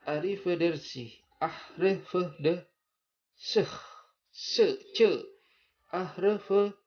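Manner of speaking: high, loud, rolled arrivederci; Italian accent. Shown as a demonstration. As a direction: belt out arrivederci Italian style. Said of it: "orang sana akan mengucapkan".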